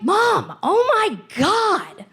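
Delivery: put-on genuinely baffled kid, voice cracking high; shocked teen. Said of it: "I'm like".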